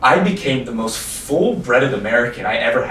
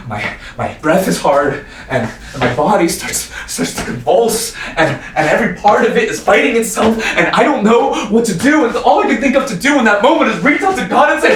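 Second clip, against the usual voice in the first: convulsing panic, climbing higher and louder; mounting breakdown; 9 semitones higher, 5 dB louder than usual. Acted. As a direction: build from halting words into near-shouting distress.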